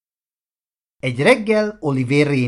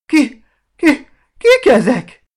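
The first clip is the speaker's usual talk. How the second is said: high, loud, stammering panic; terrified; 14 semitones higher, 9 dB louder than usual. Acted. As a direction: cry out as if about to faint with fear. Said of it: "A félelemtől félájultan kérdezte". (rémült, félájult kiáltás)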